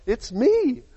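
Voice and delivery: healed man insisting to doubters; eager reassurance. Acted. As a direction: plead with delight to be believed. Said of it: "Is this really you?".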